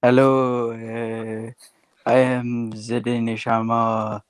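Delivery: slow, deliberate mock French-Danish accent; slow put-on French-Danish accent for a joke. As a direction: introduce yourself in a hammy fake Danish-French accent. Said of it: "I didn't know Danish people spoke French".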